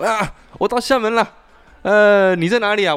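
high, loud, eager mock caller; scam caller. Fake classmate claims he has reached Xiamen, mock-eager high voice. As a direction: imitate the fake friend arriving, all eager.

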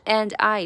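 "and I" linked as "an-I"; "and I" said as one word, no d; linked pronunciation. Shown as a demonstration. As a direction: drop the d and glide "and I" together. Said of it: "就是and I连读成and I".

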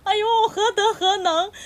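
high, loud mock-moved exclaiming; hammed-up tearful gratitude; exaggerated touched gush. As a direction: gush like you're overwhelmed with gratitude. Overwhelmed by the tribute.

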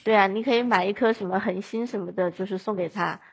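ordinary delivery

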